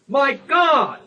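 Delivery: "God" cried out high and loud; emphatic exclamation. Shown as a demonstration. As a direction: exclaim "My God" with full weight on God. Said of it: "emphasizing that the one".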